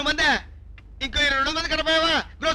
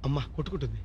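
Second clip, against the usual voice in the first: much lower and softer, almost under the breath; hushed aside; 10 semitones lower, 11 dB quieter than usual. Acted. Hiding man mutters to himself, hushed. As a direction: keep it down so nobody hears. Hiding, afraid of being caught.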